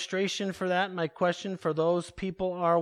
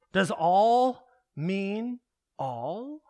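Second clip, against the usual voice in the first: slow rhetorical question; 3 dB louder, much slower than usual. Real, not acted. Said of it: "rhetorical challenge to the congregation".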